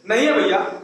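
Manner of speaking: ordinary delivery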